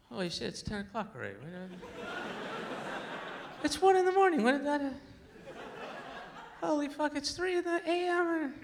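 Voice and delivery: high, escalating alarm at the clock; impression of being on heroin. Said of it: "Here's, here's my impression of being on heroin".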